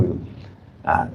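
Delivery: ordinary talk, nothing marked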